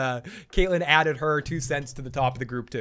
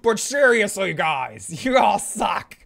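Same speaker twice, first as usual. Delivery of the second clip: high, loud mock outrage; hammed-up rant, pitched high on the insult; Joe; 6 semitones higher, 5 dB louder, slower than usual. Parodied ranter scolding all his listeners, mock outraged. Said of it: "Joe Dirty Locks".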